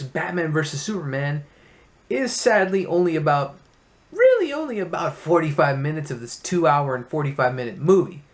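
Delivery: usual speaking voice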